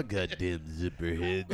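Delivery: put-on low, slow gravelly old man growl; Clint Eastwood growl. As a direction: growl it like an angry old veteran. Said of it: "beautiful imitation of that movie".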